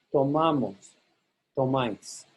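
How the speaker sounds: MA stressed in "Tomamos, tomáis", falling after. Shown as a demonstration. Teacher models outside-the-boot forms, MA stressed in "Tomamos, tomáis". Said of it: "you stress the ma syllable".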